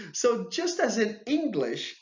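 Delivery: laughing. Real, not acted. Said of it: "laughs at the rule".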